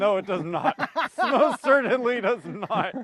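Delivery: laughing through the words, slow; amused denial with a real laugh. Real, not acted. Laughing reply to rubbing alcohol joke.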